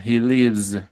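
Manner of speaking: "lives" ending in a clear voiced z; final S as Z. Shown as a demonstration. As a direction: demonstrate the buzzing z closing "lives". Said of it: "Esta S sí suena como Z". ("lives" con sonido de Z al final)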